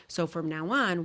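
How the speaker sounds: ordinary delivery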